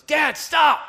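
high, very loud acted-out yell; son's explosive high shout; sudden urgent shout. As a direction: cry out to halt Dad at once. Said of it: "then real fast he goes".

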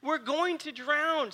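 high, loud, desperate cry for help; panicked shout. Retold or imitated. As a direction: yell as if the boat is sinking. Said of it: "woke him up shouting".

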